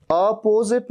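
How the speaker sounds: अपोजिट as "uh-POH-zit"; wrong uh start. Shown as a demonstration. Teacher repeating the mistaken "uh-POH-zit". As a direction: begin अपोजिट with uh and lengthen po. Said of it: "अ नहीं".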